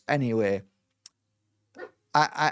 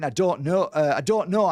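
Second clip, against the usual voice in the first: retold irritated snap, higher pitched; exasperated, raised-pitch mock anger; angry retort; 4 semitones higher, 4 dB louder than usual. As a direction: act out a mildly angry protest.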